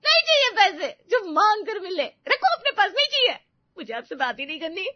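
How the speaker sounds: high, loud crying outburst; wailing wife storming off; tearful anger. Acted. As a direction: reject the husband through angry tears. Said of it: "वो रोकर room से बाहर आती है".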